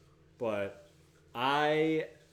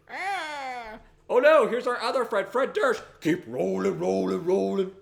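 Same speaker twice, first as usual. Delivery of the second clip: mock alarm; 7 semitones higher, 8 dB louder than usual.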